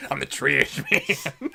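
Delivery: slow, laughing character voice; Danny DeVito. Retold or imitated. Imagining their abridged character, slow laughing celebrity impression. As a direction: drag it out in a goofy impression. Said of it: "they're gonna be Danny DeVito".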